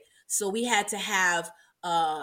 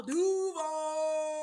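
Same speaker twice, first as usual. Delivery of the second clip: hype call; 7 semitones higher than usual. Pretend. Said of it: "What they call it, Duval?".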